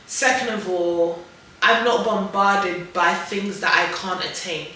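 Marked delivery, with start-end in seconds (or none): none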